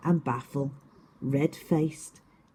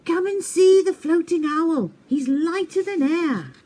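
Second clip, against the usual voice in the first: high, loud excited call; 12 semitones higher, 6 dB louder than usual. Acted. Norma calls every animal to see the floating owl, loud and high. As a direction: rouse the whole forest.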